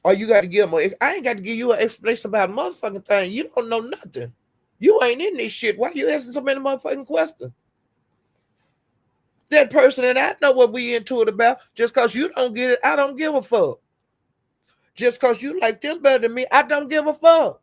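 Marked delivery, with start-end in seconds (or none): 1.0-7.5 high, rapid-fire indignant rant; irritated telling-off. Real, not acted. Telling nosy people off for asking questions, indignant. 9.5-17.6 raised pitch, indignant and emphatic; defiant rant. Real, not acted. Telling critics she doesn't care who they like, defiant.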